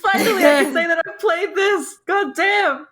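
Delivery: high, elated exclamation of relief; opgetogen uitroep. Retold or imitated. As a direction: cheer it out, laughing and high-pitched. Mimicking own excited reaction.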